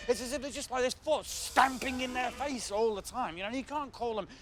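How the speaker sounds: ordinary delivery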